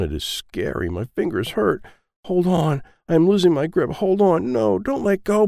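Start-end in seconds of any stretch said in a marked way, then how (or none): 2.2-5.5 high, rising scared effort; cling on in panic; boy losing his grip, high; straining fear. Acted. Child hanging from window.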